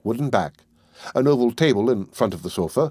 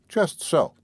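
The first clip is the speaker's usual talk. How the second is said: put-on higher, stern voice of a grasping old woman; old pawnbroker; 6 semitones higher than usual. A narrator's reading. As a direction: lay down the terms like a tight-fisted old moneylender. Old pawnbroker curt reply.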